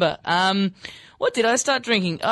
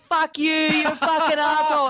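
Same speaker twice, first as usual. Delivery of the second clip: high mock yell; higher-pitched jeering yell, half in jest; retold yelling; 8 semitones higher than usual. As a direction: act out kids yelling abuse. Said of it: "they were yelling at me".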